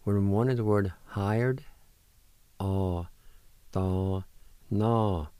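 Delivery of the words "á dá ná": each syllable separate, pauses between; syllable-by-syllable pronunciation. Shown as a demonstration. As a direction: sound out "Á dá ná hla, eh" piece by piece. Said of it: "now I'll pronounce these words".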